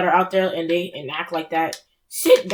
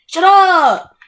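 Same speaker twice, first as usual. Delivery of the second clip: exasperated shout; 7 semitones higher, 8 dB louder than usual. Real, not acted.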